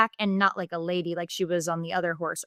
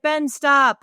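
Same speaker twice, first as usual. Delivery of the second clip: louder call to stop him; 5 semitones higher, 8 dB louder than usual. Retold or imitated. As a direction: shout his name to halt him. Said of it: "she calls out".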